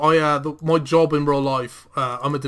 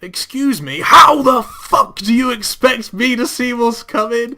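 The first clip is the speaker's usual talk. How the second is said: mock road rage, from civil "excuse me" to drawn-out shout; polite-to-raging outburst; 8 semitones higher, 7 dB louder, slower than usual. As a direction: imitate a polite opener exploding into a loud, high rant. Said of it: "he started off so polite".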